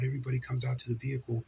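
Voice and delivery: ordinary talk, nothing marked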